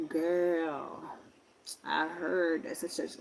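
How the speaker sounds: deadpan, softly spoken gossip; dry. Acted. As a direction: toss it out drily and quietly. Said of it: "She's more of the dry".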